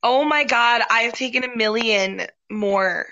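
usual speaking voice

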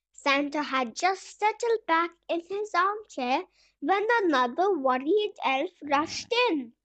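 ordinary delivery, nothing marked

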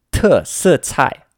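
"特色菜" as three loud, slow falling tones; three fourth tones. Shown as a demonstration. Teacher models the word for specials, three fourth tones. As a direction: drop hard on each syllable of "特色菜". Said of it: "it's three fourth tones".

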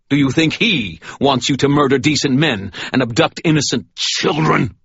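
booming righteous rebuke; stern sermon. Acted. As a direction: lay down the truth to the fallen man. Michael accuses the priest.

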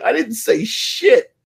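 high, drawn-out retort; indignant protest. Retold or imitated. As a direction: protest your innocence in a high, slow voice. Retold protest at being blamed.

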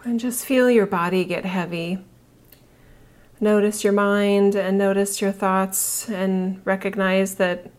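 calm her listeners into their bodies; soothing massage murmur. Acted. Leads breathing relaxation exercise.

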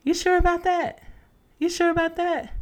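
put-on a sceptical girlfriend, high and lingering on "sure"; doubtful friend. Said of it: "It could be something to the extent of".

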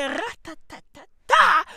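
loud rapid-fire "rat-ta-ta-ta-ta"; mock gunfire. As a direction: imitate a machine gun, punching the last beat. Imitates gunfire for sperm shooting.

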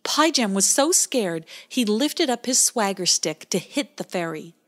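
ordinary delivery

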